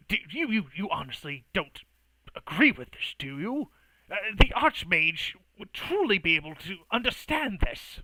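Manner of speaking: stammering, incredulous appeal; flabbergasted. Acted. Mage appealing to a bystander, flabbergasted and faltering. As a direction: stumble into it with pitch leaping.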